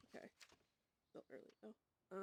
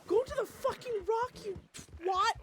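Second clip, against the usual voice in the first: higher-pitched irritated scolding; heated, pitched-up command; exasperated order; 6 semitones higher than usual. Real, not acted. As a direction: snap at a partner under pressure. Scolding partner during game scare.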